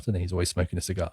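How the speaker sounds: normal talking voice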